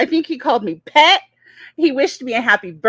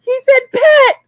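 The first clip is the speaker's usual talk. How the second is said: high, loud, breakneck delight; ecstatic fan squealing; 13 semitones higher, 5 dB louder than usual. Real, not acted. Fan whose idol called her pet, squealing ecstatically. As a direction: squeal every word with adoration.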